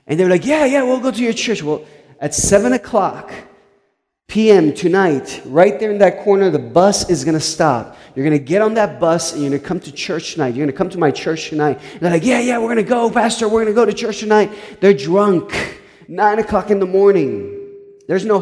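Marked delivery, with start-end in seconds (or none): 0.5-1.6 put-on eager drunks. 12.2-14.5 higher, fast tipsy promise; eager drunks promising. Retold or imitated. Drunks promising the pastor to come tonight, quick high agreement.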